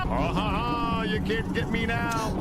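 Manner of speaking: gloating taunt. Real, not acted. Taunting enemies after tricking them.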